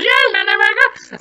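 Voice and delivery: very high, squeaky sped-up tape voice; fast-forwarded recording. Retold or imitated. Partygoer's talk boy played back, squeaky fast-forward. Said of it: "he does it fast forwards".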